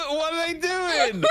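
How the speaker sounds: high, slow words through laughter; cracking up mid-question; laughing. Real, not acted. Laughing at the animation.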